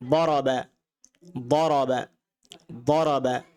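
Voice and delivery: দারাবা said in Arabic with a heavy ض sound; teacher repeats দারাবা slowly; Arabic pronunciation. Shown as a demonstration. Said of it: "আমি আরবি বলছি". (আরবি উচ্চারণে দারাবা)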